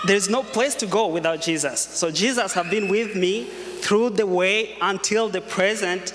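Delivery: normal talking voice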